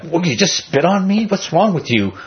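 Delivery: imitating an indignant man who was spat on; mock indignation. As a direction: act out an offended man, half-playful. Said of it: "the guy becoming indignant".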